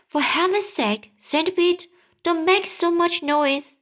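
ordinary delivery, nothing marked

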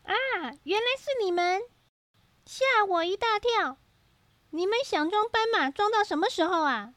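surprised. A narrator's reading. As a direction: jump in surprise. Surprised character.

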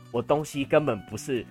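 ordinary talk

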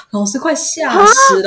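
milk the scare for laughs; dramatic fright. Real, not acted. (夸张慢速地说吓死了)

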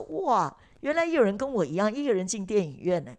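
retold amazement, starting high and loud then easing; 意外惊叹. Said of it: "但是我意外的发现". (又惊又喜地转述当时的感叹)

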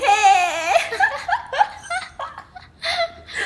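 high, loud extended laughter; long laugh. Real, not acted. Co-host laughing at not having to say goodnight. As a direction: laugh hard.

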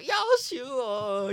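high, slow yuck sound; drawn-out disgusted groan. Pretend. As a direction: stretch it out, grossed out. Disgust at overflowing toilet. (慢慢拖长的嫌弃声)

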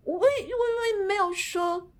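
结结巴巴、尖着嗓子慢慢辩解; 像被抓包一样慌乱地支吾; mock flustered. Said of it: "他就有点慌了". (高音慢速模仿慌张的老板)

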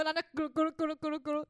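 playful imitated glugging; a light mock gulp-gulp-gulp; gulping sounds. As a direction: mimic chugging a whole mug.

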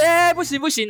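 very high mock crowd outcry; crowd protest.

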